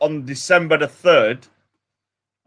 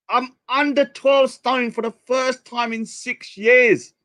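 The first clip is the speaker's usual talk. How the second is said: 1 proud repeat; 7 semitones higher, slower than usual. Real, not acted.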